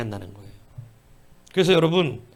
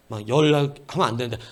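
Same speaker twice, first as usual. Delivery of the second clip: ordinary delivery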